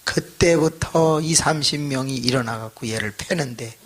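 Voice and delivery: normal talking voice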